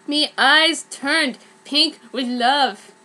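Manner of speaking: plain reading voice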